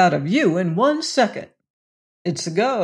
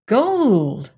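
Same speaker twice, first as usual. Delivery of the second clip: sudden gasp of surprise at the gold; stunned cry of gold; astonished exclamation; 4 semitones higher, 3 dB louder than usual. A narrator's reading. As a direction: blurt it out in disbelief. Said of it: "he ejaculated".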